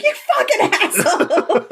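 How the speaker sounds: high-pitched mock outrage through laughter; laughing mock insult. Host voicing Shane's comeback to a dig, laughing outrage. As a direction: squeal the insult while cracking up.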